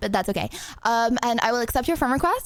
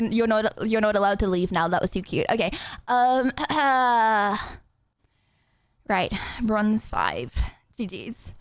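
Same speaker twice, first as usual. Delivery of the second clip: normal talking voice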